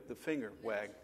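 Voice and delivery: ordinary talk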